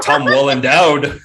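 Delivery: slow, loud punchline pun; loud joking pun. Pretend. Friend punning on an actor's name, loud slow joke. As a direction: belt out the pun slowly for laughs.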